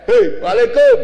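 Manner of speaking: loud, breezy shout across the road; casual street greeting. Shown as a demonstration. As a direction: call it out loud to a friend passing by. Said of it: "Engko lek ketemu nang dalan, beda meneh". (seruan akrab keras kaya ketemu kanca nang dalan)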